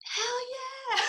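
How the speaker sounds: high cheering "Hell yeah"; imitating a friend's excited cheer; mock cheer. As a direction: squeal it like an excited fan. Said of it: "Raven was just like".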